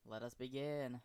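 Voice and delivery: ordinary talk, nothing marked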